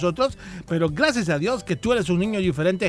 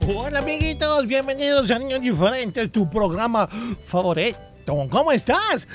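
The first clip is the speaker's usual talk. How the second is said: put-on higher kids' host greeting; bright squeaky sing-song welcome; 5 semitones higher than usual. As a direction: greet the kids like a cartoon host.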